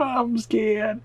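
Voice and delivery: usual speaking voice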